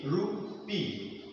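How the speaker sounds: "rupee" broken softly into ru, pee; split into syllables. Shown as a demonstration. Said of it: "This is pronounced like rupee".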